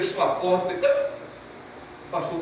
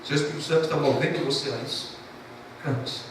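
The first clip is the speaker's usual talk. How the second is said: softer, hushed, holding back tears; mild imitated weeping, much softer; retold tearful reply; 4 semitones lower, 6 dB quieter, faster than usual. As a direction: let the voice sink as he gives in, weeping. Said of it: "começou a chorar e disse".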